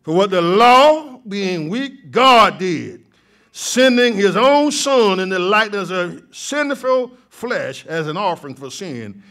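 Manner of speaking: rising pulpit fervor, loud and ringing; full-force preaching. Acted. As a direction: lift the church, proclaim it.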